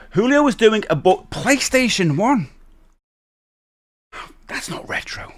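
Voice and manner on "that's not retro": hushed, voiceless protest; whispered disbelief. Pretend. Streamer reacting to PlayStation as retro, whispered. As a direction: whisper it as if aghast.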